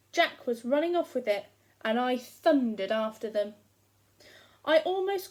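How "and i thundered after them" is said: usual speaking voice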